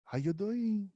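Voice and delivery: put-on high, lilting Brazilian Portuguese accent greeting; playful try at a Brazilian accent, pitched well up; mock Brazilian accent. Cohost imitates girlfriend's Brazilian accent, hammed-up Brazilian accent. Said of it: "it's Portuguese, so it's like".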